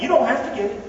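normal talking voice

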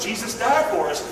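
ordinary delivery

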